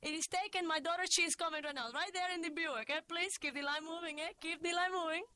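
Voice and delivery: put-on high, accented calling to drivers; mother crying out. A narrator's reading. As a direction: wave traffic on, insistent and polite. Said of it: "and cry out".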